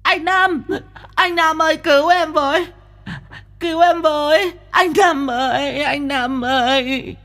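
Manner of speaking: very high crying screams for help, slowing at the end; shrieking for help. Acted. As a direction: scream for rescue in terror. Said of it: "Nó rú lên".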